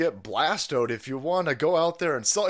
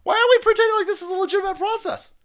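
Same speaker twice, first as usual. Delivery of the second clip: high fast yell of disbelief; outraged shout; 5 dB louder, faster than usual. Real, not acted. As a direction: fire the question out, loud and high. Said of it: "repeats the line louder".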